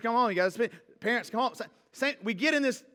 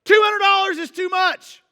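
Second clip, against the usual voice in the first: loud, much higher protest; opinionated outburst; 9 semitones higher, 10 dB louder than usual. Acted. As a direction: blurt it like a verdict. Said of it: "Opinions say".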